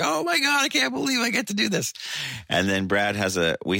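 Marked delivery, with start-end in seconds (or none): 0.0-1.9 high, fast gushing disbelief; amazed delight. Retold or imitated. Singer voicing his own joy at still playing at sixty, high amazed gush. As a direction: squeal it like you can't believe your luck. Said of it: "and it's like".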